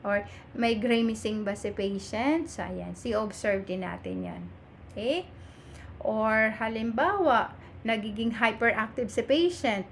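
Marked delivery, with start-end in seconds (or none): none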